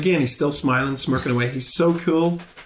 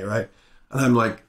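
ordinary talk

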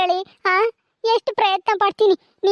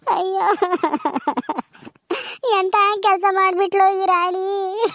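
crying lament; slower than usual. Acted. Mother cries over daughter.

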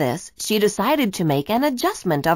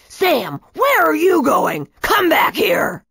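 shout; 8 semitones higher, 4 dB louder than usual. A narrator's reading. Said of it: "Brackus shouted at Coach Selfish".

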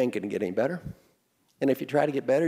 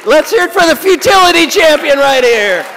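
much higher and louder, hailing a champion; mock announcer; 13 semitones higher, 15 dB louder than usual. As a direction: announce the futility champion to the crowd. Hailing audience member as champion.

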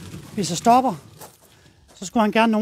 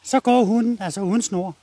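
ordinary delivery

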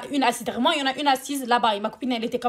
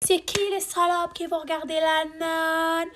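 put-on high, drawn-out drunken holler; mock wailing, held long and high; imitating drunk girls' cries; 5 semitones higher, slower than usual. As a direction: mimic rowdy girls, stretching every word. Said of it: "Elles sont rentrées en criant".

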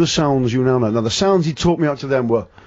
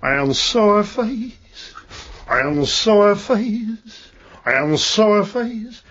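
slow, higher [name] croon; [name] impression; 4 semitones higher, much slower than usual. Impersonator croons the line for his cellmate teacher. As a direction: stretch each word out like the King. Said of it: "So I've got there".

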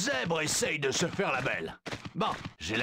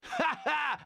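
mocking laugh; 12 semitones higher than usual. Zoo guard gloating over the zebra, mocking laugh.

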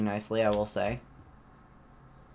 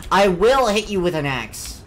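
scared horror game streamer; 6 semitones higher, 7 dB louder than usual. Real, not acted.

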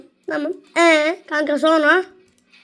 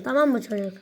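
usual speaking voice

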